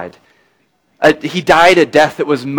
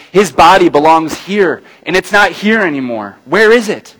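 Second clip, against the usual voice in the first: louder, rising to a high cry at the end; anguished plea; 5 semitones higher, 6 dB louder than usual. Retold or imitated. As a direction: push it up as her distress grows. Mary's anguished plea, voiced.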